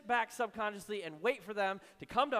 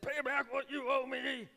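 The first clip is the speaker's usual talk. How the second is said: harsh demanding servant; 6 semitones higher, slower than usual. Acted. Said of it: "and said".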